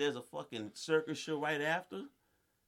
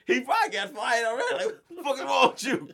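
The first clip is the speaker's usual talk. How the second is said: loud, high, laughing through the words; laughing rant at the worker; laughing disbelief; 9 semitones higher, 9 dB louder than usual. Real, not acted. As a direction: crack up while asking.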